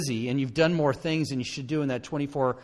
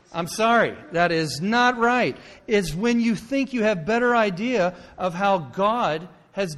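full-force preaching; 5 semitones higher, 5 dB louder than usual. Real, not acted. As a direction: drive the point home to the congregation.

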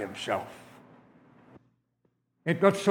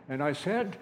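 plain reading voice

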